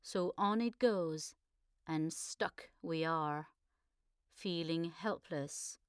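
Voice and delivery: measured, earnest poem recital; poem reading. Acted. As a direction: read the verse with feeling, rising to protest. Reads her poem aloud.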